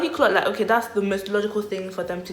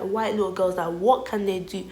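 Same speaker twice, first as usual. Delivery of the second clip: ordinary delivery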